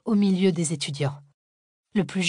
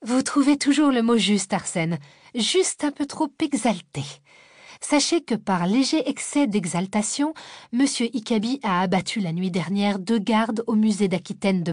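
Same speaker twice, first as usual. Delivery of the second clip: exasperated sarcasm; 3 semitones higher than usual. Acted. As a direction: roll the eyes and needle him. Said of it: "Cécile leva les yeux au ciel".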